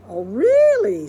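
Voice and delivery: loud, high, slow "really"; hammed-up surprise; mock surprise. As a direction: feign amazement loudly. Mr Rogers feigns surprise.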